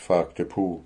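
usual speaking voice

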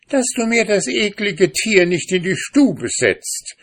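sehr hoch und laut, angeekelt; empört rufen; angewiderte Frau. A narrator's reading. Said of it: "rief ihm die Frau entgegen". (Frau ruft dem Mann an der Tür entgegen)

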